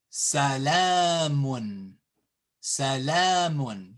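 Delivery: salamun with a long held la; salamun, slow, "laa" stretched; long vowel. Shown as a demonstration. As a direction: draw out the middle a of salamun. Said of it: "so we say salamun".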